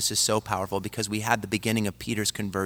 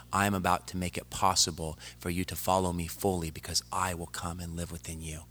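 much softer, gentle voice of Christ comforting Peter; tender reassurance; 3 semitones lower, 7 dB quieter than usual. Retold or imitated. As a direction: hush down and reassure him like a loving friend. Christ comforts Peter across courtyard.

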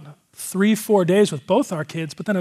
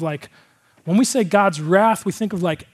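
normal talking voice

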